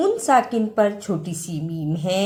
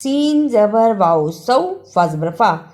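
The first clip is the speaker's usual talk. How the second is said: ordinary delivery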